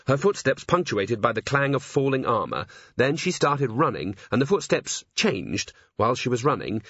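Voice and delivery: usual speaking voice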